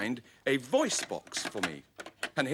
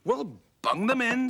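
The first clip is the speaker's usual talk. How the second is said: much higher brisk puppet voice; high Sooty voice; 10 semitones higher than usual. A narrator's reading. Sooty telling Scampy to add lozenges, high and brisk. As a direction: pitch it up, breezy. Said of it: "said Sooty".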